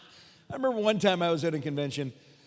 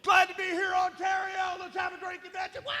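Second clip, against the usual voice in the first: high-pitched shouted convention greeting; over-the-top stage hype; introduction shout. Retold or imitated. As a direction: yell a hyped opening welcome, very high. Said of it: "we're doing our whole introduction".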